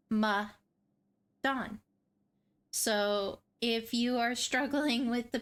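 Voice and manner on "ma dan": Ma-dan split slowly into "muh ... DAWN"; syllable-by-syllable pronunciation. Shown as a demonstration. Host correcting viewers' pronunciation, Ma-dan slowly split as "muh, DAWN". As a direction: say Ma-dan in two separate beats, muh, DAWN. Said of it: "It is pronounced".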